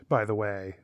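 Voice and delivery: usual speaking voice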